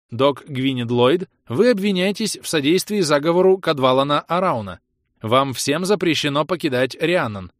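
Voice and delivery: an AI broadcasts to the whole colony; machine announcement. Acted. The colony AI announces to all colonists, loud and machine-even. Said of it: "Ровный бесполый голос машины".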